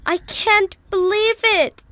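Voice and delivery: loud, high amazed cry; excited cry. Acted. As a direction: burst out in delighted disbelief. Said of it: "cried the prince".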